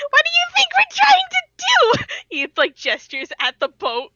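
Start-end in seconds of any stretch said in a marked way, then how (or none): 0.1-2.0 high, loud, exasperated outburst; exasperated yell. Acted. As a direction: yell back in sheer frustration. Acted quarrel with hostile toon.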